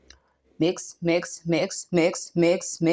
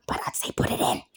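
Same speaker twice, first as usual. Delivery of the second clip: soft whispered tip; whispered aside; 4 dB quieter than usual. Real, not acted. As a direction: whisper it like a secret. Conspiratorial aside.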